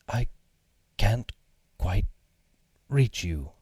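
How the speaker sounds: strained, broken into gasps; straining while stretching. Acted. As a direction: reach with effort between words. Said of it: "grunting as he stretched".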